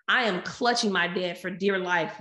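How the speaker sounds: ordinary delivery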